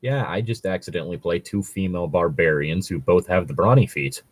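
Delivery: ordinary talk, nothing marked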